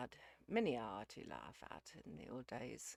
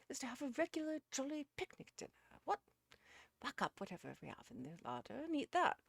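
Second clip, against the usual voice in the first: high, loud, jolly gentleman; jovial; 11 semitones higher, 7 dB louder than usual. A narrator's reading. Uckridge proposes a picnic to his guests, jovial. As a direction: announce the treat with hearty gusto. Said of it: "said Uckridge in a jovial manner".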